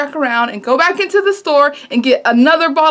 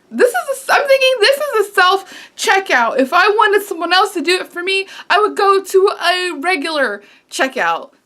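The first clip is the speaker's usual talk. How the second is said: irritated thoughts; 7 semitones higher than usual. Retold or imitated. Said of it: "Just getting a little irritated".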